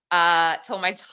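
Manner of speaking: real laugh cutting off the word; words dissolving into a laugh; breaking into laughter. Real, not acted. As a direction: start the sentence, then crack up.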